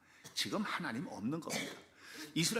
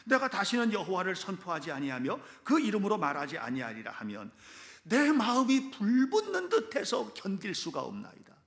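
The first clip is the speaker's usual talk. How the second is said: raised, slowing solemn reading building at the end; scripture reading; 2 semitones higher, 5 dB louder than usual. Acted. Preacher reads Jeremiah's verse aloud to the congregation, fervent. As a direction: read the verse with burning fervor. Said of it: "한 구절 제가 읽어드리겠습니다".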